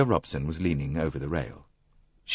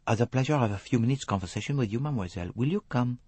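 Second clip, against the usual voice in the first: Hercule Poirot; 6 semitones higher, faster than usual. Acted. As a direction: invite her politely in a French accent.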